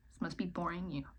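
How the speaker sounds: soft whispered apology to viewers; hushed self-deprecating whisper; whispered aside. Real, not acted. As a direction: murmur it like an embarrassed aside.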